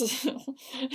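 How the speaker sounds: quick high giggle; soft giggle over an apology. A narrator's reading. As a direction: laugh it off lightly. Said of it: "started laughing too".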